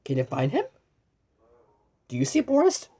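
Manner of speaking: ordinary delivery, nothing marked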